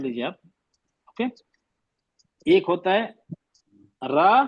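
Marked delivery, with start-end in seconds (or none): 4.1-4.4 را with open aa, light; light raa. Shown as a demonstration. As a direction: say را with a clear open aa ending. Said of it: "را، آ کی آواز آ رہی".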